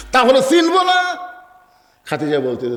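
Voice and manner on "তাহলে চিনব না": very high rising exclamation; emphatic retort. Acted. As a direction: throw the question up high in protest. Acted emphatic retort to wife. (উঁচু গলায় জোরালো পাল্টা প্রশ্ন)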